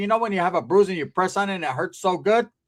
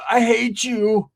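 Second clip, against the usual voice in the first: slow, loud, raised mock taunt; hateful crank caller; 5 semitones higher, 5 dB louder, much slower than usual. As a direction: drag out the insult, higher. Prank callers' abuse acted out.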